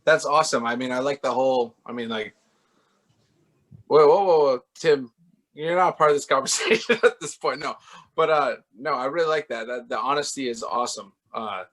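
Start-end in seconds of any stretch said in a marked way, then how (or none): 3.9-7.2 loud playful whoa; loud joking interruption. Real, not acted. Playfully cutting off a confession.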